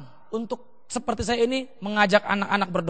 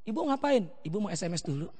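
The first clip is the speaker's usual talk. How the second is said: imitated distracted tutor; 5 semitones lower, 12 dB quieter than usual. Distracted tutor texting, voiced.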